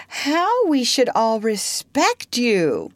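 slow, high-pitched flattery; cunning. A narrator's reading. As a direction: coax her slyly, stretching the words. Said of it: "He had become frightfully cunning".

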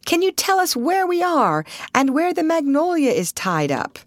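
high-pitched called question; calling out. A narrator's reading. Lost traveller asking a boatman the way, calling out. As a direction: call the question across the water. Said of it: "called Russ".

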